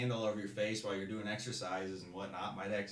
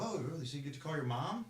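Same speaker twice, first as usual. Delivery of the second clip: mocking drill instructor; 7 semitones higher than usual. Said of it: "He was like".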